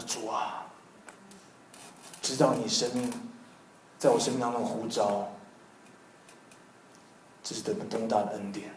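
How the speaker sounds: soft, reverent thanks to God; hushed grateful prayer. Retold or imitated. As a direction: pray softly, moved.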